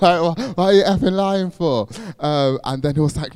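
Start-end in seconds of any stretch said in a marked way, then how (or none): 0.0-1.9 higher and louder, laughing through the mock accusation; laughing imitation. Re-quoting the friends' accusation, laughing at it. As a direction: repeat their line while cracking up. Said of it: "literally, that's what they said".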